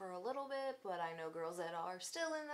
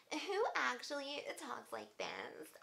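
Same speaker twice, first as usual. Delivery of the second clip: a hint of the girl's put-on voice, higher and drawn out; mock valley girl voice; 6 semitones higher, slower than usual. Mocking how the girl on the phone talks, light valley girl voice. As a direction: lightly parody her forced girly tone. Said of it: "You literally have to force that voice out".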